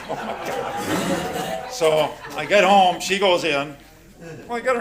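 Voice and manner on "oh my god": unvoiced, very slow, through laughter; breathy laughing. Real, not acted. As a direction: gasp it out while laughing. Laughing disbelief at wife.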